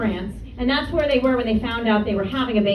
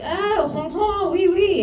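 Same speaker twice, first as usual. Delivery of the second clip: higher mock-French cooing; 4 semitones higher, slower than usual. People react to the child's French name, mock French. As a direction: play up a cartoon French reaction.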